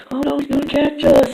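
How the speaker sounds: high, loud frightened squeak; cartoon panic. Turd fleeing Bartholomew, high and loud panic. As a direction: squeal it while being chased. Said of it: "he's like".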